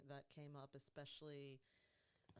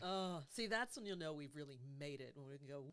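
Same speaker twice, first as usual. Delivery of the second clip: ordinary talk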